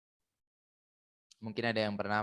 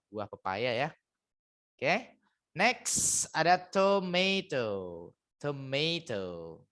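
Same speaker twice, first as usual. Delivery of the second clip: usual speaking voice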